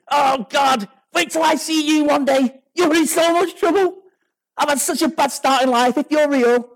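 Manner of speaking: high, mock-bold challenge to God; hamming up his younger self's threats; mock defiant taunt.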